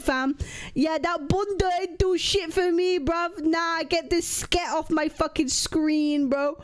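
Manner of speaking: put-on full London roadman accent. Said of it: "You gotta use that British roadman slang".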